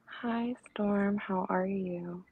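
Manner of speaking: light, hushed, airy greeting; near whisper. Real, not acted. Guest greets the host Storm in a near whisper. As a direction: say it softly and slowly, barely above a whisper. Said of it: "You, you whispering".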